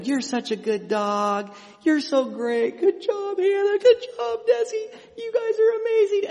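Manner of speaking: high, loud sweet-talking voice to the dogs; praising the dogs. Acted. Preacher praising his two labs in a very high, loud voice. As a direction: gush over them in a very high, loud voice. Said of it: "I can say to them".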